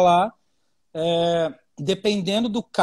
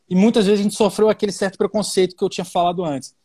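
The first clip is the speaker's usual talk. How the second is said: ordinary talk, nothing marked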